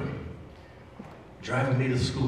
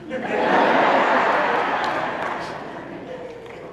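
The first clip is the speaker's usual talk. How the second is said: laughing; 11 semitones higher than usual. Real, not acted. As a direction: let a short knowing laugh slip out. Laughs after "you figure it out.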